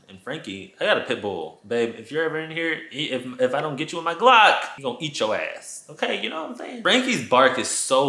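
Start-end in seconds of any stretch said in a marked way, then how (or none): none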